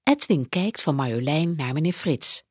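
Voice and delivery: plain reading voice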